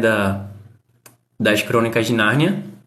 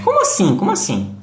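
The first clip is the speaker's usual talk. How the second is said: puzzled student; 5 semitones higher than usual. Pretend. Voicing a confused student.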